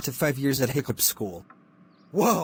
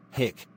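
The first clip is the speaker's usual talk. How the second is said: hiccup; 2 semitones lower, 4 dB quieter than usual. Acted. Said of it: "stop doing that".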